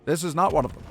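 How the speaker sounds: lightly voiced vintage radio host; old radio announcer. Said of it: "old-timey radio announcer, I can do".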